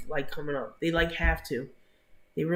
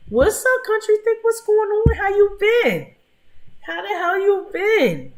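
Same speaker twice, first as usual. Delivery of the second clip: much higher and louder, a hollered welcome; excited greeting; 7 dB louder, slower than usual. Real, not acted. Streamer hollering hello to a regular joining the chat, high and loud. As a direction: shout a happy hello across the room. Greeting a viewer who arrived.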